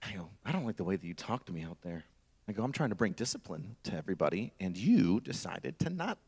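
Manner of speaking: low, soft, measured menace; softly threatening sergeant, taking his time; quiet drill sergeant. Retold or imitated. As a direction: keep it hushed and deliberate. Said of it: "Quiet, drill sergeant".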